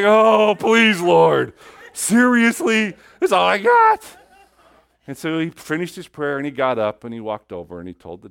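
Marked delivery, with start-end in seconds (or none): 0.0-4.2 high, loud, drawn-out whining plea; mock pleading whine. Preacher voicing a listener begging God to spare his last coin, loud. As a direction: beg the Lord not to take it.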